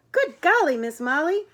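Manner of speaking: loud, thrilled outburst; delighted exclamation. Acted. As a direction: burst out loud and high, then drop. Acted exclamation at joyful reunion.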